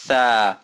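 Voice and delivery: THA held long, A as in fashion; fashion A sound. Shown as a demonstration. Teacher reminding learners of the vowel, long open THA. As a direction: stretch the A in THA wide and long. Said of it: "Remember that fashion sound".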